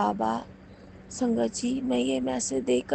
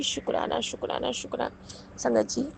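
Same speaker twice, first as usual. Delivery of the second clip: whispered thanks; 4 dB quieter than usual. Real, not acted. Whispered thanks after prayer.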